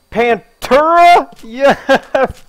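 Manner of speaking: high, loud, stretched slowly; excited cry. Real, not acted. Gamer thrilled to find the Pantera room, high drawn-out cry. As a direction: drag it out with delight.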